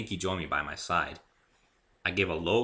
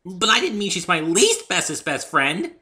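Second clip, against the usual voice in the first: high, loud slow flustered pony; backpedalling Pinkie; anxious bubbly correction; 12 semitones higher, 10 dB louder than usual. A narrator's reading. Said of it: "said something she shouldn't have".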